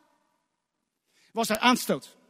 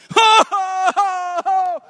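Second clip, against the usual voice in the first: mock wail; 8 semitones higher, 7 dB louder than usual. People weeping, crying sound.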